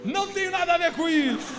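high, full-volume protest, acted out; shouted denial. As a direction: yell your innocence across the street. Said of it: "Eu falava assim". (grito de protesto, bem alto)

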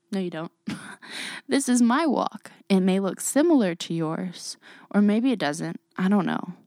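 ordinary talk

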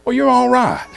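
a hint of a grumpy old man letting it go; old neighbour's voice. Preacher imitating the neighbour as he softens, lightly voiced. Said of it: "And he goes".

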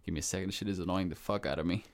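usual speaking voice

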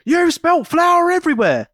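hammed-up indignant scold; mock outrage. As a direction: imitate a fussy parent's outrage. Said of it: "the outrage".

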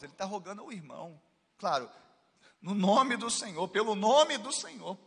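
usual speaking voice

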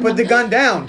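hammed-up insistence; mock command. Boy ordering the Terminator to disarm, mock command. Repeats boy's order amid laughter.

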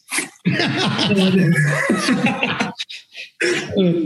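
real high laugh. Real, not acted. Laughing at the guest's joke about thanking him, real laugh.

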